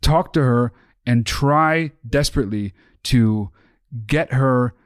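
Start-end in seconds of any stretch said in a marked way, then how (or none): none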